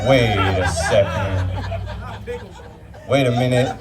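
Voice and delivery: slow, low dawning realization; mock realization. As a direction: let it sink in, slowly. Realization mid-story.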